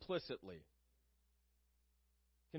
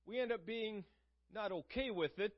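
ordinary talk, nothing marked

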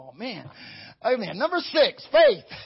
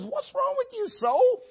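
much higher, urgent rebuke to the soul; psalmist chiding his soul; 13 semitones higher than usual. Retold or imitated. Psalmist questioning his own soul, high and urgent. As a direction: call out to your own soul, pitched high. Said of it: "So the psalmist says".

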